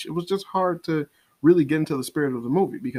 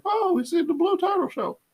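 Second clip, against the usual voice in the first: mock fan excitement. Mocking viewers swept up by blue shell nostalgia, high fake excitement. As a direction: imitate a gushing fan, pitched way up.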